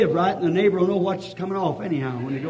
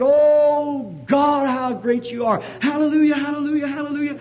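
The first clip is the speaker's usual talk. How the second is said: joyful shout of praise; 7 semitones higher than usual. Retold or imitated. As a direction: burst out praising God. Shouting praises alone on mountain.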